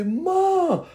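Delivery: high-pitched, stretched-out cry for mom; retold yelling. Child spotting mom with attacker, retold yelling. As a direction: call out in reenacted alarm. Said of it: "And I was yelling".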